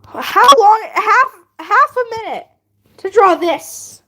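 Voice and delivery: high, loud disbelieving question; incredulous outburst. Real, not acted. Streamer guesses the drawing's time, incredulous outburst. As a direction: exclaim it, slowing on the last words.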